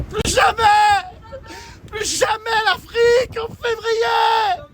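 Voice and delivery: high, slow wailing yell; despairing shout. Real, not acted. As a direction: wail it out half-crying. Freezing, yelling at the storm. (cri désespéré, lent et aigu)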